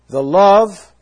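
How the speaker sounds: "love" punched high and loud; "love" thrown out with a sudden lift; stress on love. Shown as a demonstration. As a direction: hit "love" hard and high, like a pulpit point. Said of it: "the wording here is an emphatic position".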